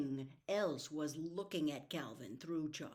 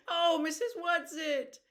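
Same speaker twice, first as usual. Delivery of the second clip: despairing call; 11 semitones higher, 9 dB louder than usual. Acted. Meg calling Mrs Whatsit in despair, high loud call. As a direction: cry out for rescue that won't come. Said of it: "Meg called despairingly".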